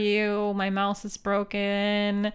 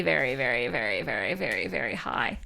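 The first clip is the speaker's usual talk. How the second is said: sinking lower and quieter; 11 semitones lower, 5 dB quieter, faster than usual. Real, not acted. Streamer exaggerating the odds, voice sinking low and soft. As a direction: let the pile of verys sink down low and quiet.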